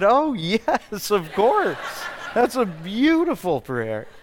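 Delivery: laughing reply. Retold or imitated. Retelling his delighted reply.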